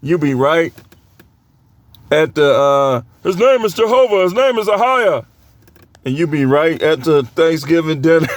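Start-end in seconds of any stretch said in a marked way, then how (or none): 3.2-5.2 mock preacher voice. Mocking believer at Thanksgiving dinner.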